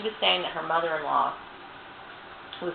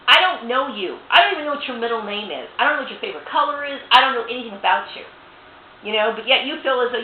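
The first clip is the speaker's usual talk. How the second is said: higher and louder, real exasperated anger; angry at the ex-boss, higher and louder; heated rant; 5 semitones higher, 5 dB louder than usual. Real, not acted. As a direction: fire it straight at her, indignant. Ranting at the boss directly.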